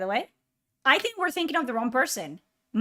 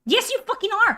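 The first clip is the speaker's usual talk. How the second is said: higher, louder angry retort; exasperated shout; 9 semitones higher, 5 dB louder, much faster than usual. Real, not acted. Streamer confirming friend is embarrassing, exasperated shout. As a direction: fire back in exasperation. Quarrel over mistaken actor.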